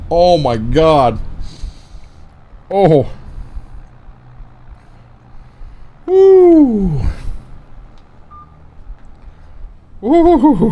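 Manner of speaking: high woo from sour shock; eating sour candy. Real, not acted. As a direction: yelp at the sour hit. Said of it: "I just put it in my mouth".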